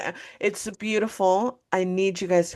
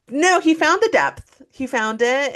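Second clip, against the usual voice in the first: excited; 8 semitones higher, 5 dB louder than usual. Real, not acted. Excited defence of actor.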